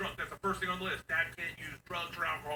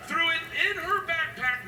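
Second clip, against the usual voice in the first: louder, high incredulous telling; outraged; 12 semitones higher, 7 dB louder than usual. Retold or imitated. Dad telling crowd about rice, outraged. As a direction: climb to the punchline.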